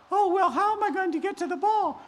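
high sweet princess voice; high girlish Cinderella. Acted. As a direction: greet him in a high girlish voice. Cinderella asks the wolf.

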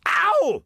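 sudden high yelp of pain; king cries out. Acted. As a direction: yelp as a coin hits your head. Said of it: "cried the king".

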